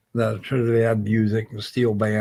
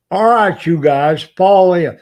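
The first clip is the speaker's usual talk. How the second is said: barked drill command, much louder and higher; best military voice; 5 semitones higher, 10 dB louder, slower than usual. Retold or imitated. Sailor ordering his two buddies to fall in, in a military voice. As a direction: call the squad to fall in like a drill sergeant. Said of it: "in my best military voice".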